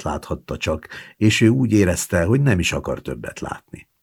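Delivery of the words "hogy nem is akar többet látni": ordinary delivery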